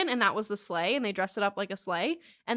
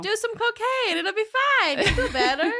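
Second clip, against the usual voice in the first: high, loud, laughing mock doctor; jokey high doctor voice; 11 semitones higher, 7 dB louder than usual. Mock doctors advising sedation.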